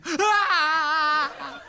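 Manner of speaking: loud hammed-up sobbing wail; mock wail. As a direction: bawl like an upset kid. Crying kid voiced.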